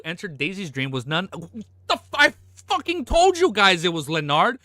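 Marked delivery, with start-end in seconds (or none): 2.1-4.6 high, loud I told you; gleeful vindication; loud taunting brag; triumphant gloat. Real, not acted. Gloating at chat.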